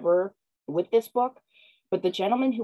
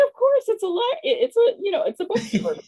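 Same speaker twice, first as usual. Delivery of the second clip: high, giddy and tumbling over her words; flustered excitement; 13 semitones higher than usual. Retold or imitated. Author urging the stranger to look at her book, high and flustered. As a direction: gush at him, too excited to finish a sentence. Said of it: "I'm like".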